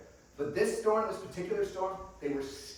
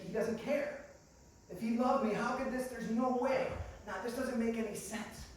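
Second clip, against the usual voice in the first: higher-pitched, aggrieved protest; a touch of hurt disbelief, pitched up; mildly distressed doubt; 7 semitones higher than usual. Acted. Believer doubting God in life's storm, mildly aggrieved.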